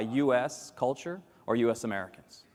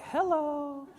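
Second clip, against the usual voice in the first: high, breathy mock hello; 13 semitones higher than usual. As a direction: lightly voice a stranger peeking in. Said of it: "People are like".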